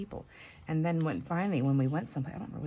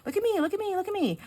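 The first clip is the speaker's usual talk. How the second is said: put-on higher, louder whiny child's plea; attention-seeking little kid; 5 dB louder than usual. Voicing the jealous dog begging for attention, whiny kid voice. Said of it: "She's like a little kid".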